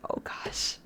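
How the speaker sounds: breathless whispered oh gosh; hushed nervous reaction. Real, not acted. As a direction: whisper in dismay. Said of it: "whispered reaction".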